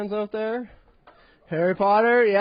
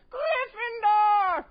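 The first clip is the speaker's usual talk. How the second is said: very high, slow wailing shout; mentally challenged wizard; 14 semitones higher, much slower than usual. Retold or imitated. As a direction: drag the house name out in a high cry. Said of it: "my impression of a mentally challenged wizard".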